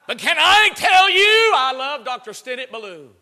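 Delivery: high, loud, stretched-out cry; impassioned preaching. Real, not acted. Preacher builds to heartfelt declaration.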